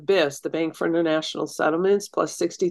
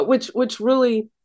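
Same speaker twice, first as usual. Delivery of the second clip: usual speaking voice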